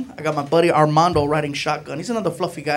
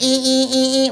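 mocking angry women; 7 semitones higher than usual. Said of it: "they're getting all mad".

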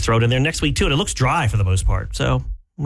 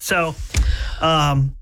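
usual speaking voice

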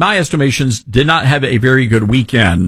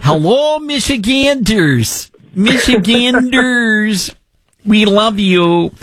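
slow, much higher, put-on Michigan accent, stretching out Michiganders; mock Haley Stevens accent; 9 semitones higher, slower than usual. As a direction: ham up her accent as if greeting the crowd. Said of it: "I love her accent".

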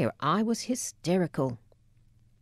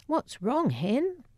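Scottish mother; 9 semitones higher, slower than usual. Retold or imitated. Mum rushes over, Scottish.

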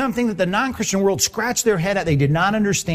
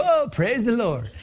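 higher, slow, sing-song fake delight; mock cheerful praise; 6 semitones higher, slower than usual. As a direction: pretend to be happy about the towing. Mocking an absurd cheerful reaction.

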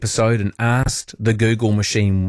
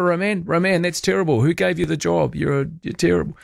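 higher-pitched imitation of an indignant caller; mock complaint; 6 semitones higher than usual. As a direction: scold like an unimpressed listener. Acts out an outraged listener.

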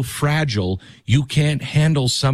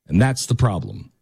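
ordinary talk

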